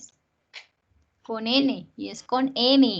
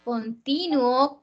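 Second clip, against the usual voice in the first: Continuo said slowly with the weight on TI; demonstrating Continuo slowly for the learners; slow, stress on TI; much slower than usual. Shown as a demonstration. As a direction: stretch Continuo out and press TI. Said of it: "pronúncialas y presta atención".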